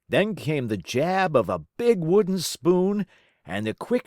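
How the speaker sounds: usual speaking voice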